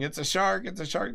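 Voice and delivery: high-pitched imitation of a panicked warning yell; mock yelling. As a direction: cry out in mock alarm, pitch jumping up. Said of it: "he starts yelling".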